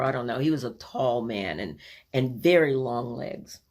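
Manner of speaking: ordinary talk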